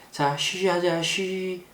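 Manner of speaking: lightly voiced parent, soft coaxing shushes; parent coaxing toddler. Parent helping a young child pee, soft coaxing. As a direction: softly coax a small child with shushing. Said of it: "어린아이 이제 오줌 누일 때".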